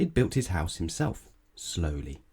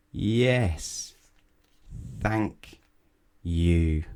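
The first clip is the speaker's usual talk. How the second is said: slow shouted reply; much slower than usual. Acted. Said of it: "he'll shout".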